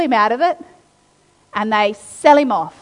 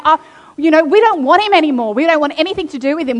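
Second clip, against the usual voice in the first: higher, louder grumbling brothers, quickening at the end; mock resentful brothers; 5 semitones higher, 6 dB louder, faster than usual. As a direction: act out the fed-up brothers loudly. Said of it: "they're like".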